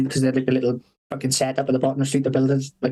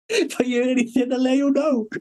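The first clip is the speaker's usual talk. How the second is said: mock innocent promise; 10 semitones higher than usual. Sly boss promising to watch out.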